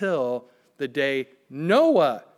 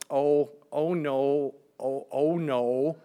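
slow, drawn-out "oh no"s; retold dismay; slower than usual. Wife realizing her water broke, imitated slow dismay. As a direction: imitate a wife's dawning dismay, slowly. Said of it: "She goes".

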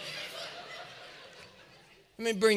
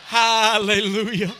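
loud, drawn-out hallelujah; hammed-up churchy exclamation, very slow; mock praise shout; 8 dB louder, slower than usual. Churchgoer praises silly song's meaning, loud mock rapture.

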